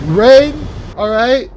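excited yell. Real, not acted. Hyped reaction to trailer reveal.

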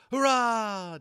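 high, loud joyful cheer; giddy triumphant hurrah; joyful cry. A narrator's reading. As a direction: cheer it out dizzy with joy. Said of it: "Dennis cried, grinning dizzily".

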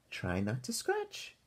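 soft, slow granny voice; soothing grandma. Acted. Grandma Thora soothing itchy Arthur, soft and slow. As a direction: coax him gently like a grandmother.

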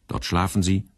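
ordinary delivery

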